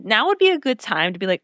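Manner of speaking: ordinary talk, nothing marked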